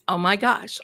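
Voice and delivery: ordinary delivery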